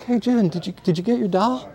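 high, casual feigned innocence; playing it cool. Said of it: "I was really cool".

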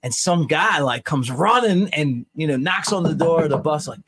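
usual speaking voice

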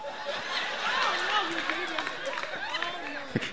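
a long, high burst of laughter at his own joke; laughing at himself mid-sermon. Real, not acted.

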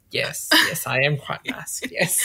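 hushed attempt at a masculine man; put-on masculine. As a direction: drop low and soft, playing manly. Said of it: "Can you do your most masculine voice".

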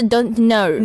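slow "don't know", "know" toward Australian "naur"; Australian accent. Shown as a demonstration. Host tries out the Australian accent on don't know. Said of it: "它也是don't know".

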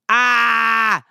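a short pained wail; mock anguished wail; anguished cry. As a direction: cry out like it still hurts. Anguish recalling Red Wedding.

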